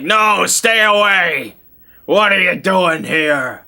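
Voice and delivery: high, slow, drawn-out warning cry to strangers; eerie horror-movie caretaker warning; ominous warning. Retold or imitated. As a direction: ward them off like a spooky groundskeeper. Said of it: "Very ominous horror movie cliche".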